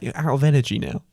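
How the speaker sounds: normal talking voice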